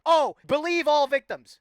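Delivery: slow, high, loud scoff at the slogan; mocking slogan. Streamer mocking the believe-all-victims slogan, scoffing loudly. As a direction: sneer it out like a slogan you despise. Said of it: "I don't stick with the".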